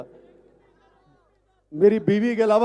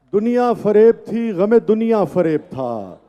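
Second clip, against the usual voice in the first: measured, emphatic verse recitation; poetry recital; 3 semitones higher, 4 dB louder, slower than usual. Acted. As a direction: recite it to a live audience. Recites ghazal at mushaira.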